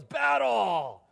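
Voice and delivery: loud, high war cry; yelled battle cry. Retold or imitated. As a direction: bellow it like a charging angel. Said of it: "He just yells".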